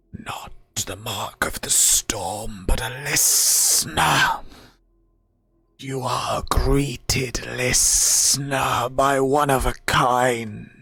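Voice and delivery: slow, whispered spirit voice; rasping undead whisper. Acted. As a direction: hiss it like an ancient guardian. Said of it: "the voice changes slightly".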